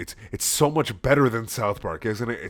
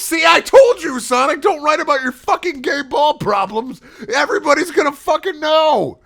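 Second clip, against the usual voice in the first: much higher, louder mom yelling; shouting mom; 9 dB louder than usual. Acted. Mom yelling at Sonic about his writing, high shout. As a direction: bellow it at your son, high and loud.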